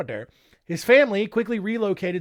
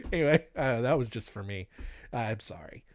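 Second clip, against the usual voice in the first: ordinary delivery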